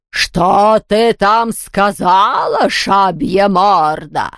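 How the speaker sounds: slow, drawn-out furious villain voice; outraged shriek. A narrator's reading. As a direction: stretch the words in ghostly outrage. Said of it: "взвизгнула Яспера".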